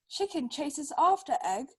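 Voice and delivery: ordinary delivery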